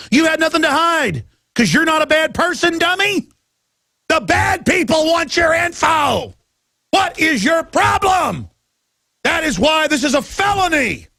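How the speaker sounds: furious high-pitched tirade, voice straining; angry shouting rant. Real, not acted. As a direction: blow up at the naive listener.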